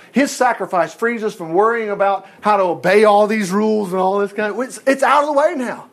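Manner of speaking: loud, high, driving sermon; a preacher warming to his text, loud and high; fiery preaching. Acted. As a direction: preach it with full force. Preaching at full force.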